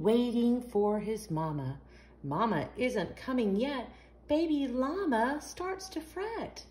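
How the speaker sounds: ordinary delivery, nothing marked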